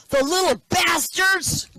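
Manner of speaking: high, loud, slow startled yell; naked man jumping back in fake surprise; startled outburst. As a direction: leap back cursing the moths. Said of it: "He goes".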